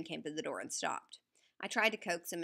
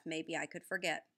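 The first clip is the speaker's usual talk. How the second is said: ordinary delivery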